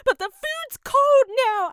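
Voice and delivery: very high, loud, slow whine; petulant sulk. Retold or imitated. To her boyfriend about the cold food, a very high, loud, slow whine. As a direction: drag it out like a spoiled kid.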